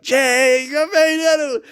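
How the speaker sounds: thrilled reunion cry; excited shout. Retold or imitated. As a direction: call out overjoyed at making it. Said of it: "and I was like".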